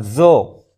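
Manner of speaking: ज़ो with a slightly heavy emphatic consonant; heavy zo sound. Shown as a demonstration. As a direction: show ज़ो as a thick Arabic sound. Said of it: "थोड़ी भारी आवाज़ होती है".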